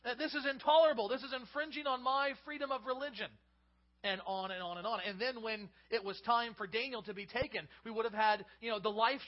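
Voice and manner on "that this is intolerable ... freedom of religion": mock protester. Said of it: "and saying".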